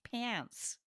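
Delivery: high, soft copy of American "Pants"; attempted American accent. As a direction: try the American vowel in "Pants". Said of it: "I'm gonna learn your accent".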